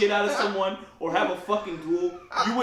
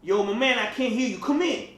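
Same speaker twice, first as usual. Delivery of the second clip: put-on street accent; 4 semitones higher, 4 dB louder, slower than usual. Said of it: "changes tone and his accent".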